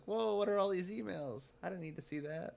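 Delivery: high-pitched mock alarm, dropping a little; mock shocked reaction. Acting out stumbling on a friend's emails, mock shock. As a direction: act startled by an unwelcome discovery. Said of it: "suddenly I'm like".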